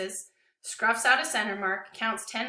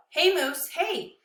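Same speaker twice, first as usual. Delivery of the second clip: high, raised call to get the dog's attention; 8 semitones higher, 4 dB louder, slower than usual. Acted. Boy trying to pull the dog's attention away, calling out. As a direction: call to him, trying to be heard. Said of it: "My voice is nowhere near as powerful".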